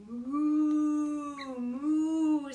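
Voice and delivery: mock cow call; cows mooing. A narrator's reading. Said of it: "said the cows".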